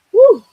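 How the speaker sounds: a sudden high, loud "woo"; excited whoop. Real, not acted. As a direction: let out a quick happy whoop. Whoop mid-apology about tired voice.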